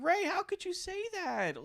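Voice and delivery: high, slow, stunned plea; hurt disbelief at a betrayal; shocked disbelief. Retold or imitated. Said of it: "I'm like".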